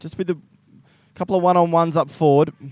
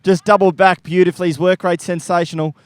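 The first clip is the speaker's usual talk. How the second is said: rising, louder play-by-play as the forward leads; excited live call; 4 semitones higher, 7 dB louder than usual. Acted. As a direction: lift the voice and call the mark. Praising the mark right after.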